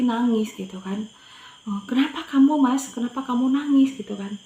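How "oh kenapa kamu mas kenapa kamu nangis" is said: higher, louder mother's questioning; retold concerned question. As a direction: ask a crying child with mild alarm. Mother asks her crying son.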